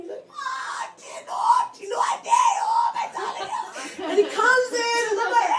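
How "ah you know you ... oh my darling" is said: high, squealing mock greeting; excited uncle. Uncle greeting relative at the door, excited high squeal. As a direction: imitate an overjoyed uncle, voice way up high.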